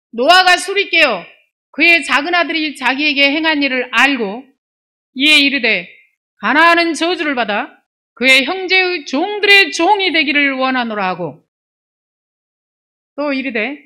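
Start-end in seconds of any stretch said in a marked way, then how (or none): 0.1-11.1 raised, ringing scripture recitation; solemn read-aloud Bible voice. Acted. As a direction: proclaim the verse, high and forceful.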